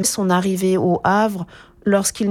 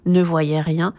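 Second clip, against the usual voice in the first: ordinary delivery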